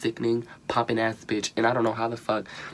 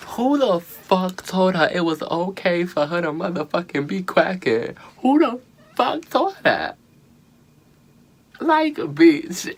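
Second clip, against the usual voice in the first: louder, much higher, incredulous rant; outraged disbelief; 8 semitones higher, 5 dB louder than usual. Real, not acted. Recapping Morgan's awful lip sync, shrieking in disbelief. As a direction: shriek in disbelief between laughs.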